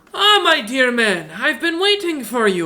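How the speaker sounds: merchant voice. Acted.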